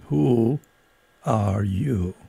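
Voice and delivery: slow, drowsy caterpillar drawl; languid, sleepy. A narrator's reading. Caterpillar addressing Alice, languid and sleepy. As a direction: let it drift out lazily. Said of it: "a languid, sleepy voice".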